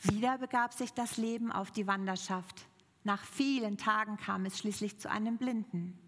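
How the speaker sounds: ordinary talk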